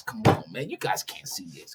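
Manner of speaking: muttered to himself, unvoiced; frustrated whisper. Real, not acted. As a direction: breathe it out as a whispered aside. Frustrated aside about the screen.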